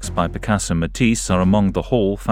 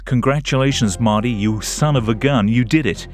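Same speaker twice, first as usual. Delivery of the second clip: a bright, higher, hammed-up American accent; mock American accent, voice pitched up; best American accent; 4 semitones higher than usual. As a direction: play a jovial American politician, in an American accent. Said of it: "this is my best American accent".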